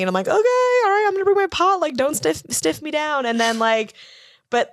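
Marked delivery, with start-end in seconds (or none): 0.3-3.2 high, bright, teasing retort; cheeky mock quote. Retells cheeky reply to DEA agent.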